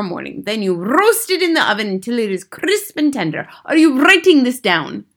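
lightly voiced commanding witch; theatrical witch giving orders; imperious head witch. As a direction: dictate a wicked recipe with emphatic relish. Grand High Witch scolds, instructs.

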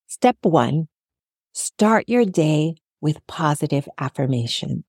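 ordinary delivery

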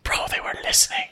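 soft, fully whispered aside; urgent whisper. Retold or imitated. Confiding in best friend after the cops pass, retold whisper. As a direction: whisper it like a secret to your mate.

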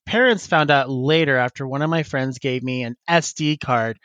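ordinary delivery

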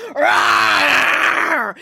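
loud troll roar; monster roar. Acted. Troll leaps out roaring.

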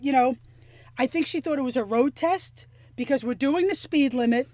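ordinary talk, nothing marked

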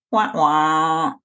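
drawn-out "wah, wah" fail sound; sad trombone noise. Acted. Reader mocks the man coming to climax, sad trombone. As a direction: imitate a deflating game-show trombone.

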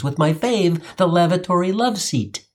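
normal talking voice

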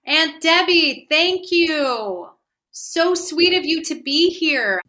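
loud greeting. Real, not acted.

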